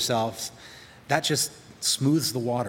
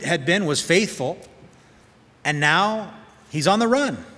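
impassioned preaching; 5 semitones higher, 3 dB louder than usual. Acted. Impassioned preaching on David's plight.

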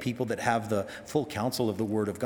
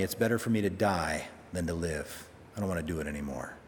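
defeated Jonah; 7 semitones lower, 4 dB quieter, faster than usual. Retold or imitated. Jonah wishing to die.

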